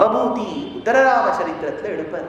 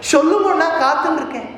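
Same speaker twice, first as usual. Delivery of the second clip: higher, louder, quick answer; eager younger brother, voice lifted; eager reply; 7 semitones higher, 5 dB louder, faster than usual. Acted. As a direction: jump in readily, pitched up. Lakshmana answers his elder brother's request.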